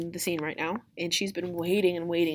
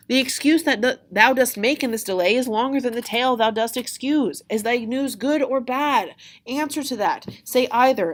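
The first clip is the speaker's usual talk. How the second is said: high, loud, insistent demand; acted exasperation, pitched well up; exasperated Juliet; 6 semitones higher, 7 dB louder than usual. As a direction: badger the stalling nurse for an answer. Juliet demands news from stalling nurse.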